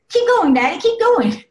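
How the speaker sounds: excited little girl begging to keep playing. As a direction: squeal it like a giggly little daughter. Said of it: "and then she'd go".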